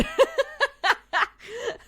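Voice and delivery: real giggle, high and loud; bright laughing fit; high burst of laughter. Real, not acted. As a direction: laugh it off loudly. Laughing at own remark.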